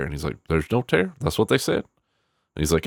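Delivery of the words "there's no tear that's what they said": incredulous coach. Retold or imitated. Coach voiced doubting Boobie.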